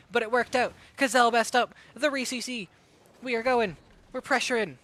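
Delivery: rapid, rising esports commentary; live play-by-play. Real, not acted. Caster calling the match for stream viewers, hyped play-by-play. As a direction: call every move as it happens.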